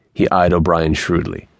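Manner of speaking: ordinary delivery, nothing marked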